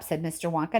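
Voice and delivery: plain reading voice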